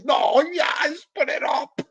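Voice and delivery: high, shouted impression of a friend; mock shout. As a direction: yell it in a squeaky put-on voice. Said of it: "Assassino just went like this".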